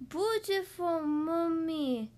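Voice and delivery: put-on high, very slow baby coo. A narrator's reading. Baby admires Mommy, cooing. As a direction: coo it like an adoring toddler. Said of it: "cooed the baby".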